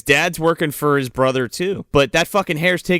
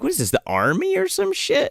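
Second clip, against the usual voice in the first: mock incredulous; 12 semitones higher than usual. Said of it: "I'm like".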